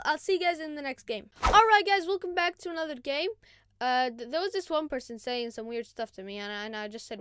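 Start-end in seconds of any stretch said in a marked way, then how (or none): none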